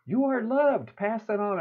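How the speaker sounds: high, bright little critter voice; cartoon critter. Critters hugging the little elephant, high and cartoonish. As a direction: squeak it out like a tiny cartoon animal. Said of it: "Then they go to this little elephant".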